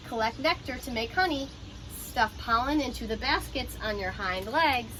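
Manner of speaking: ordinary talk, nothing marked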